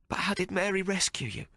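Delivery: high, wounded brother's voice; Tomo, hurt. Acted. Tomo telling Hawk of Slit's beatings, high and hurt. Tomo's voice held.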